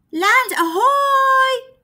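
loud, high, drawn-out call; shouting. Acted. Rossi spotting land, shouting from the ice. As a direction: shout it out across the sea. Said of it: "shouted Rossi".

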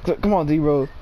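ordinary talk, nothing marked

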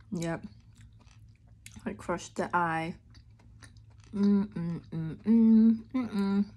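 chewing while humming; muffled closed-lip speech; mouth full of candy. Real, not acted. Seen 1: bites lollipop at -1, then closed-lip chewing through the labelled words.

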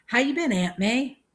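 high, loud greeting. A narrator's reading. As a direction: call it out to an elderly aunt. Grandma talks to deaf elder.

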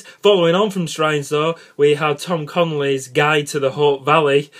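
usual speaking voice

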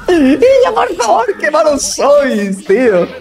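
loud, high exasperated yelling; raging gamer. Real, not acted. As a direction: yell at useless teammates, half laughing. Jugador frustrado con su equipo. (gritando exasperado)

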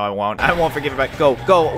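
ordinary talk

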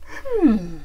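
loud bear voice thinking aloud; pondering bear. A narrator's reading. Bear checks the soup ingredients, loud pondering bear. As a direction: ponder it like a big bear cook. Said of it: "said Bear".

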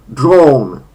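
drawn said loud with a lengthened O; long O in drawn. Shown as a demonstration. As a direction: hold the O in drawn. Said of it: "wydłużone o". (drawn z długim o)

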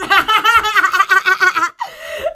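long run of rapid laughs; real laugh bursting out. Real, not acted. Telling the story of the fallen shorts, laughing.

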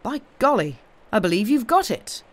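high, loud exclamation; impressed outburst, voice lifted; amazed realisation. A narrator's reading. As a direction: exclaim it, newly convinced. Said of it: "drew a deep breath and then said".

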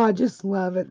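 ordinary talk, nothing marked

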